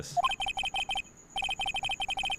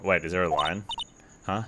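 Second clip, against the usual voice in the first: ordinary talk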